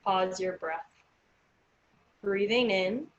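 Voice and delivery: slow, calm counting of breaths; guided breathing. Acted. Leads counted breathing exercise.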